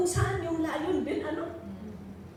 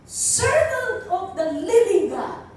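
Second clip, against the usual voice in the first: louder, slow pleading call; anguished plea; 2 semitones higher, 8 dB louder than usual. Acted. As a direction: plead the words slowly and loudly. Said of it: "in an anguished voice".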